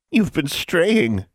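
slow, high, menacing witch voice; drawn-out hag accusation; sinister hag voice. Acted. Hag accuses straying student.

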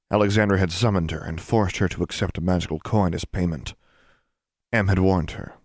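usual speaking voice

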